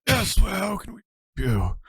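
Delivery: high, slow, quavering elder; a frail old priest speaking; old man voice. Acted. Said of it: "I can do the old man voice".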